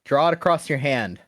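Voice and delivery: called out loud; shouting. Acted. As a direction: shout the command across the room. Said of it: "shout out".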